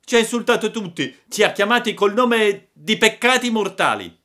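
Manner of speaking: high, loud indignation; outraged shout. Acted. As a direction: rouse the gang over an insult. Insulted boy rouses the gang. (grido indignato)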